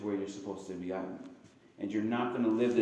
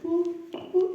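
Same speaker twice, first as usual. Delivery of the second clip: cartoon boop. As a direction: squeak it like a monkey tugging its stuck hand. Jungle man pulling the cord.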